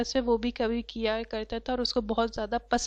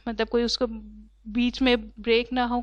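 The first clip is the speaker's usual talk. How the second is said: ordinary talk, nothing marked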